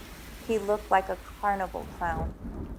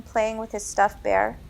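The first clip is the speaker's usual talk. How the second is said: ordinary delivery, nothing marked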